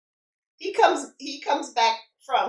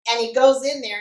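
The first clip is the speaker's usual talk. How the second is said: normal talking voice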